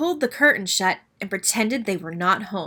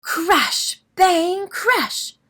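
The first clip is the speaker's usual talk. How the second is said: thunder crash; 9 semitones higher, 4 dB louder, much slower than usual. A narrator's reading. Storm noises read out.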